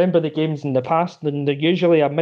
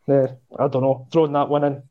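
ordinary delivery

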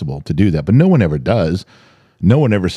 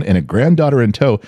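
normal talking voice

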